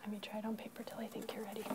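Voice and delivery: unvoiced private whisper to a student. Real, not acted. Teacher whispering aside to a child, hushed.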